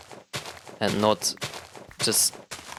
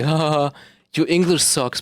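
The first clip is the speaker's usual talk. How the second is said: higher, louder jeering voice; mocking commenter; 5 semitones higher, 5 dB louder than usual. YouTube commenter attacking his English, mocking put-on voice. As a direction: jeer like a heckler in the comments. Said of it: "no one can go into the YouTube comments and say".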